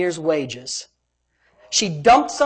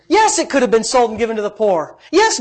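ordinary delivery

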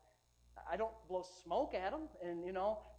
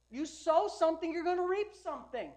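loud, high preaching cry; fired-up preacher voice; 8 semitones higher, 9 dB louder than usual. Acted. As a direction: drive the point home to the congregation. Preaching at full force.

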